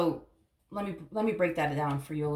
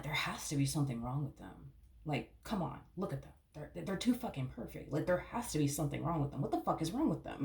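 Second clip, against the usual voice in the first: soft, suspicious mutter to yourself; suspicious inner mutter; 8 dB quieter than usual. Retold or imitated. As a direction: mutter it like a doubting thought you keep private. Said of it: "you're looking at this person like".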